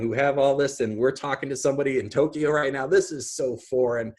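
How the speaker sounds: usual speaking voice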